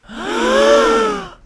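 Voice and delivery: big shocked gasp, high and loud; loud theatrical gasp. Acted. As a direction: gasp out loud in mock horror. Boss reacts with a loud gasp.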